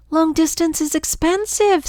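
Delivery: worried sister crying out over the bill; alarmed cry. Acted. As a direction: yelp it out in alarm. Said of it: "sister cried".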